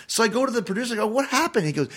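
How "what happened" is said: incredulous question. Retold or imitated. Confronting the producer.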